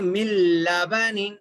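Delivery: मिन लबनिन read mil-labanin, with held l; merged lam recitation. Shown as a demonstration. Teacher modelling the last example, merged lam. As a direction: drop the n of मिन लबनिन into a long l. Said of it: "बिल्कुल ही गायब करके".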